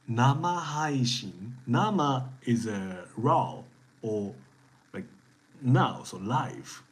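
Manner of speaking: each syllable of 生配信 drawn out slowly and clearly; slow teaching pace. Shown as a demonstration. Teacher slowly modelling 生配信 for the class, careful and clear. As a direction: spell out 生配信 slowly for the learners. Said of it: "When you say 生配信".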